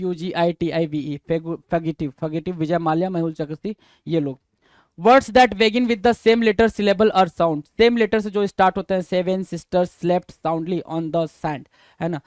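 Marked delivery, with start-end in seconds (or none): 5.0-7.7 reading the definition out very slowly; drawn out, each word separate; slow dictation. Shown as a demonstration. (बहुत धीमी आवाज़ में परिभाषा पढ़ना)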